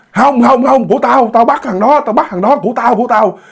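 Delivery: high, greedy refusal; cling to it, pitched high; possessive refusal. Retold or imitated. Ghost woman claims the child.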